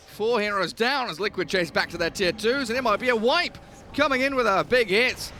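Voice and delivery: fast, loud, rising teamfight call; excited play-by-play. Real, not acted. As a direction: build the hype with every kill. Teamfight casting.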